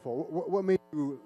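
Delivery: normal talking voice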